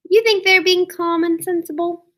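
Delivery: usual speaking voice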